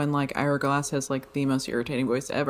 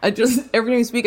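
normal talking voice

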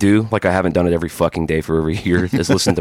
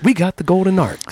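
much higher, put-on voice for the Golden Arcs; Coming to America impression; 10 semitones higher than usual. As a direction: mimic the McDowell's owner with a raised voice. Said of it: "a little more Coming to America".

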